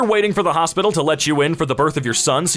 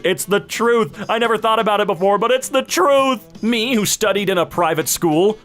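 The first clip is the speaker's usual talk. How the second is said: usual speaking voice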